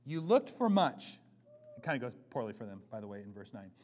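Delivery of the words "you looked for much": scripture reading. Acted. Reading God's words.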